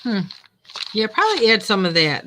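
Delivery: usual speaking voice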